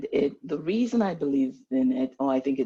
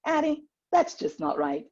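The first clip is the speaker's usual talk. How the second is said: Southern friend; 10 semitones higher than usual. Retold or imitated. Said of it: "she tells me".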